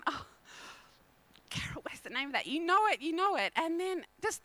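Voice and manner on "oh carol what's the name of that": straining to remember. Retold or imitated. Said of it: "squeezing my brain going".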